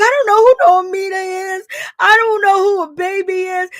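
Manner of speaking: exaggerated fake crying wail; mock crying denial. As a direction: ham up a fake tearful denial. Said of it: "And she was like".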